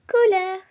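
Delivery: Couleur said loud and clear in French; Couleur, projected for the class to copy. Shown as a demonstration. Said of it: "Repeat after me".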